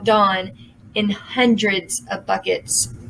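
ordinary delivery

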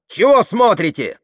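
high, loud challenge; aggressive. A narrator's reading. Tamara advancing on the crowd, aggressive. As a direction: shout it as you bear down on them. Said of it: "наступала она".

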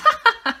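high cackling laugh, loud; old woman's laugh. Acted. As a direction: let out a loud granny cackle. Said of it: "The old woman laughed".

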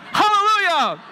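high, loud joyful holler; boy's hallelujah shout. Acted. Preacher closing the story with the boy's shouted hallelujah. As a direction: cry it out like the happy boy.

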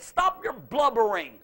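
loud, slow, high rebuke; God scolding a frightened Moses; commanding and impatient; stern rebuke. Retold or imitated. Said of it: "basically God said".

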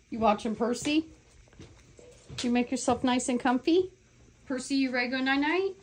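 high sing-song cooing. Real, not acted. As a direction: coo to the bird at bedtime. Cooing bird goodnight.

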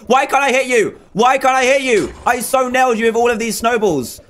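high-pitched indignant gamer complaint; exasperated protest. Real, not acted. Gamer's shots fail to land.

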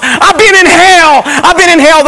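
mock yell of a man back from death; retold scream. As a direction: cry out desperately. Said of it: "I'm screaming to the top of my lungs".

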